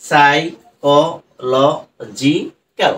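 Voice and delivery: সাইকোলজিক্যাল stretched out very slowly, one syllable at a time; teacher sounding out সাইকোলজিক্যাল syllable by syllable; broken into syllables. Shown as a demonstration. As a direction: pull সাইকোলজিক্যাল apart into separate beats. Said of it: "কি করে একদম ভেঙে ভেঙে উচ্চারণ করছি".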